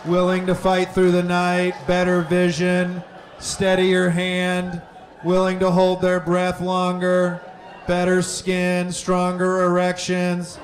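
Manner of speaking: ordinary talk, nothing marked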